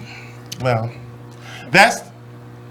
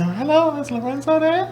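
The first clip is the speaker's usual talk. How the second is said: mock effeminate voice, much higher; effeminate caller; 9 semitones higher, 5 dB quieter than usual. Friend calling the house, mimicked effeminate and high. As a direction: put on a high, soft effeminate voice. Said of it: "his voice was very effeminate".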